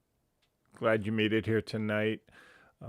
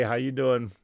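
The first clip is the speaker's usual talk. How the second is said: normal talking voice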